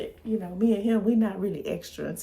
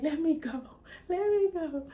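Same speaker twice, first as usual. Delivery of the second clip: high, rising imitation of a child begging; frantic child; 9 semitones higher than usual. Son strapped in the harness, in a high frantic plea. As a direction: mimic a strapped-down child crying to be freed.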